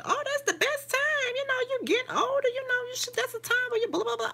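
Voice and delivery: high, mocking voice of everyone; mocking falsetto. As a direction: ridicule their advice in a squeaky falsetto. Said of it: "Everybody keeps saying".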